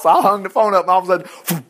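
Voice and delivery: ordinary talk, nothing marked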